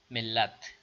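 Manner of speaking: usual speaking voice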